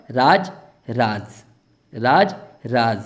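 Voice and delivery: राज said slowly, the first with a plain j sound, the second with a z sound; nukta pronunciation. Shown as a demonstration. Teacher to Hindi students, demonstrating राज with j then with z. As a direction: contrast राज with j against राज with z, drawn out. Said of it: "अब इसका क्या उच्चारण होगा?".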